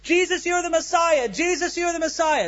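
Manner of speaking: high-pitched mock shouting; imitated street shouting. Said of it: "out in the street shouting".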